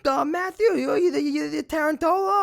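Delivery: high-pitched flustered recognition of someone known; high, stammering mock surprise; starstruck acquaintance. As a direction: lightly mimic someone recognising you, pitched well up. Voicing people who recognise his family.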